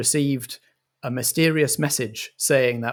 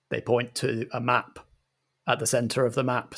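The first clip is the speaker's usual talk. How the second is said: ordinary delivery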